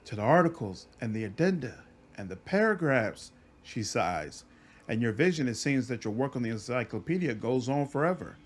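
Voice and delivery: usual speaking voice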